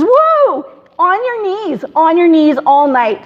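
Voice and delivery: high, emphatic retold objection; urgent protest. Woman retelling her plea to a praying friend, urgent protest. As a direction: cut in high and insistent.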